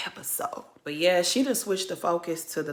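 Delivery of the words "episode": teasing whisper. Real, not acted. Vlogger whispering to her viewers, teasing. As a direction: drop to a whisper on the last word.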